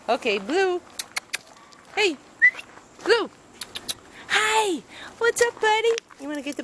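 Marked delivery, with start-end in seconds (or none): none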